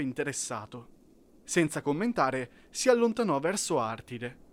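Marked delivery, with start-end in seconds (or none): none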